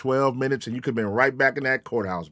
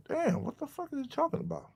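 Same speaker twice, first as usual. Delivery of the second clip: high and much softer, baffled; high stunned whisper of disbelief; high, hushed bewilderment; 10 semitones higher, 11 dB quieter than usual. Retold or imitated. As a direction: ask it in a stunned hush. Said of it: "I was like".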